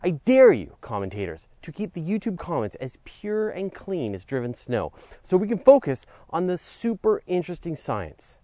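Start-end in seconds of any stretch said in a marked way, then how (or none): none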